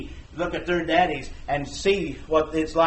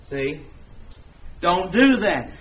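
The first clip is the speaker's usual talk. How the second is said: usual speaking voice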